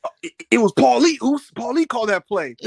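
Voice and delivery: high, loud stammering imitation; excitable wrestler answering the boss; Jimmy Uso. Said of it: "And he was like".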